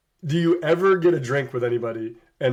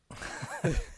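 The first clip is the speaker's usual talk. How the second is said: 1 silent breathy laugh; 4 semitones higher, 10 dB quieter than usual. Retold or imitated. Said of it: "we always just go".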